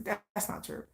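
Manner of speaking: breathy hushed whisper of That's not true; almost voiceless, trailing That's not true; whispered denial. Retold or imitated. Denying the rumors, repeated.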